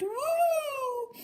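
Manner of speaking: a very high yippy woo; dog bark. Acted. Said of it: "who barked".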